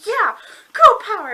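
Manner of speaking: loud, high whoop; triumphant cheer. Retold or imitated. Fan cheering as she slams him down, triumphant high shout. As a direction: cheer her on at full volume.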